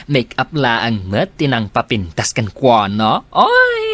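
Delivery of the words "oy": sudden high, loud "Oy!"; excited exclamation. Acted. Co-worker teasing Rhea about her remark, high yelp. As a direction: yelp it out playfully.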